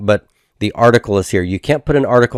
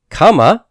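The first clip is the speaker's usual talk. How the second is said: KA of "kama" punched high, second syllable light; stress on first syllable; 9 semitones higher, 4 dB louder than usual. Shown as a demonstration. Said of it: "where we're stressing the kof, the root one".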